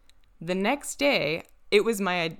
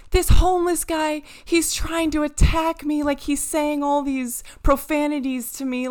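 higher and louder, a panicked woman's complaint; frightened lady; 8 semitones higher, 5 dB louder than usual. Retold or imitated. Retelling the scared customer, a higher panicked voice. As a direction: play the scared lady rushing in. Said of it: "she's like".

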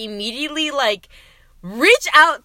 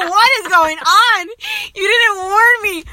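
higher, louder, laughing through it; laughing outcry; 9 semitones higher, 6 dB louder than usual. Real, not acted. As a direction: cry out mid-laugh. Startled by co-host's jingle.

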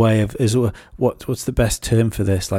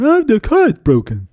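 put-on high, childlike whine; little son's voice; 12 semitones higher, 5 dB louder than usual. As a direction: play the small son complaining. Son whining about broken car.

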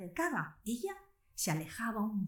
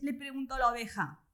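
usual speaking voice

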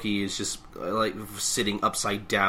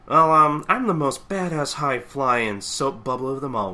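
louder, higher swaggering boast; cocky Gintoki; 6 semitones higher, 7 dB louder than usual. Retold or imitated. Gintoki bragging to the fire chief, louder cocky swagger. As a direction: brag like the coolest guy in the room. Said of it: "he's like".

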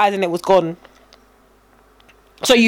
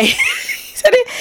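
high squeak of laughter through the words; words squeezed out while giggling; laughing; much slower than usual. Real, not acted. Laughing while retelling the story.

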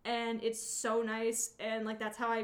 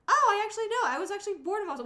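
mock excited classmate; 10 semitones higher, 9 dB louder, much faster than usual.

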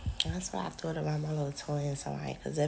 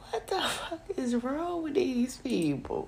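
slow, higher words through laughter; laughing disbelief; 9 semitones higher, slower than usual. Retold or imitated. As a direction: laugh it out incredulously. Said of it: "I'm like".

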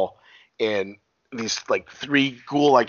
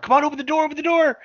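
much higher, pleading mock panic; panicked begging; 4 dB louder, faster than usual. Teens begging the girl to open the door, panicked. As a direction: beg like someone locked out.

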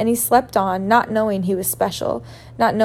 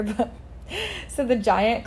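plain reading voice

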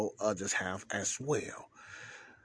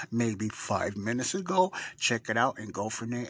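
normal talking voice

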